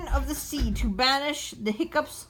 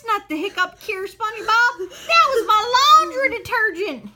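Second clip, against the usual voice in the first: high, loud, outraged scolding; shrieking indignant complaint; angry shout; 8 semitones higher, 4 dB louder than usual. Acted. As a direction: yell at the fool who drank your detergent. Squidward scolds SpongeBob.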